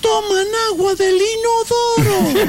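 very high, slow outraged punchline; indignant squeak. Acted. Comedian protests the dogs drink toilet water, high and slow. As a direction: squeal it in mock disbelief.

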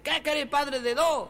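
high, slow irritated retort; mock angry. Fed-up wife answering her husband, mock angry. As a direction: throw it back with mild mock anger. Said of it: "ella, enojada".

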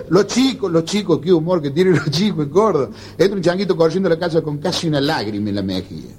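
ordinary talk, nothing marked

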